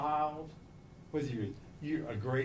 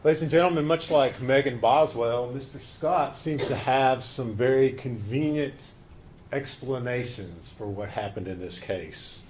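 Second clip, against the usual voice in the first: loud, forceful courtroom address; passionate closing argument; 3 semitones lower, 6 dB louder than usual. Acted. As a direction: drive it home to the jury. Prosecutor opens closing argument.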